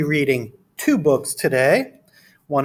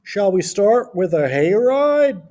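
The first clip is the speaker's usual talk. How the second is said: slow, high-pitched farmer character; put-on storybook farmer, slow and raised; Farmer Brown; 5 semitones higher, 4 dB louder, slower than usual. A narrator's reading. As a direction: welcome visitors in a drawn-out farmer voice. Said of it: "said Farmer Brown".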